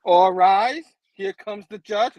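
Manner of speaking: loud opening, then drawn out; mock bailiff announcement. Joking priest opens the wedding, loud courtroom announcement. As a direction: call the room to order in jest.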